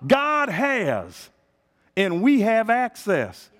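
God has rising, we have access shouted slow; preaching shout. Shown as a demonstration. Pastor proclaims to the church, slow and shouted. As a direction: proclaim it to the congregation. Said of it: "That's how he said it".

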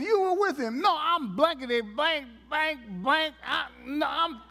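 mock flustered cursing; mock cussing Peter. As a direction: ham up a flustered denial. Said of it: "he started cussing".

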